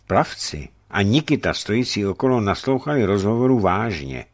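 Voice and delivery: ordinary delivery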